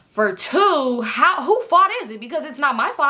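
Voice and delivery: mock exasperated fury; heated rant. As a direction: push back hard at her. Angry rant at girl.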